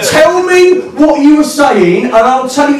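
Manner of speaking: slow, high-pitched emphatic challenge; insistent demand. Retold or imitated. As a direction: hammer out each word, mock-exasperated. Argument loops, demand repeated more slowly.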